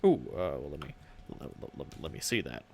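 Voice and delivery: low, quiet stammering orc; nervous orc clerk. Acted. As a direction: fumble the words like an anxious orc.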